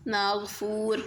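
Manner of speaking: ناگپور said with Arabic gh for g and f for p; Arabic-style ناگپور, "ghf" in place of "gp"; Arabicized pronunciation. Shown as a demonstration. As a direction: say ناگپور as "Naaghfoor". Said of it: "پا کی جگہ فا لکھیں گے".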